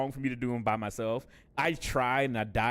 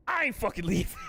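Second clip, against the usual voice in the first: Wolf of Wall Street quote; 8 semitones higher than usual. Retold or imitated. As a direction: belt out the famous line. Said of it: "What's the Wolf of Wall Street quote?".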